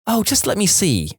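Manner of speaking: a hint of a brushing-off mother; impatient mum. Mum brushing off her crying child, lightly impatient. Mum voiced at dressing room.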